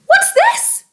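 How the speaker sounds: high shocked gasp. Acted. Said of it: "gasped Missus Twit".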